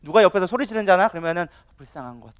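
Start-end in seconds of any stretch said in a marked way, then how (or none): 1.8-2.3 low, very soft remark; scornful pity. Acted. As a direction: murmur pity, quite unbothered. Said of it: "우리는 비웃어". (낮고 작게 불쌍해하는 말투)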